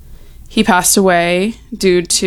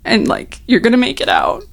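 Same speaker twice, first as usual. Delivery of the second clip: on the verge of tears; 4 semitones higher than usual. Real, not acted. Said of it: "I'm gonna cry".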